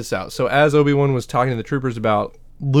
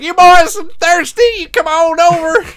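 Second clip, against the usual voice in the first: very high, louder, slow country drawl; folksy trucker invite; 9 dB louder than usual. Retold or imitated. Trucker calling troopers into his truck, high slow drawl. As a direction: ham up a friendly backroads driver.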